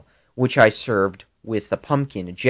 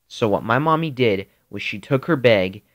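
ordinary delivery, nothing marked

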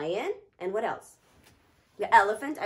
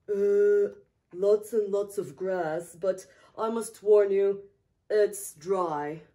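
elephant voice; 4 semitones lower, 5 dB louder, slower than usual. Acted. Elephant character speaks.

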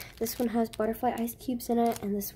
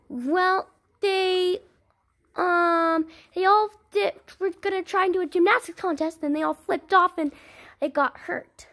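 put-on excuse-making kid voice; 5 semitones higher, 6 dB louder than usual. Child makes excuse.

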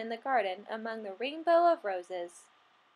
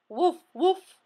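louder doggy woofs; dog barking; 5 semitones higher, 5 dB louder than usual. Acted. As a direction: bark it like a friendly dog. Classroom full of pet noises.

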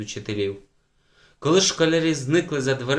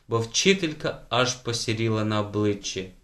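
plain reading voice

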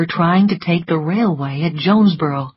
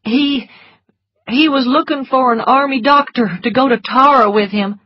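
higher, halting soldier's reply; nervous young-soldier answer, pitched up; 7 semitones higher, 4 dB louder than usual. A narrator's reading. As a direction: stumble at the start, uneasy. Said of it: "The soldier looked nervous".

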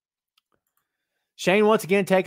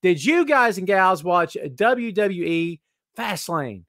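ordinary talk

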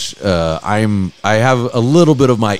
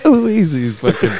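Bill Hader character; 6 semitones higher than usual. Retold or imitated. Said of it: "where he goes".

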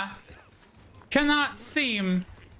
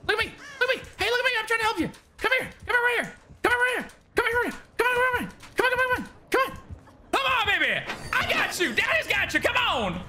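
put-on soothing a child; much faster than usual. Coaxing a teammate to follow.

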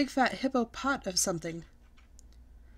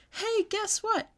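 much higher, slow excited holler; 9 semitones higher, 3 dB louder than usual. Acted. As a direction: yell the news across the house to Grandpa. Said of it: "I hollered".

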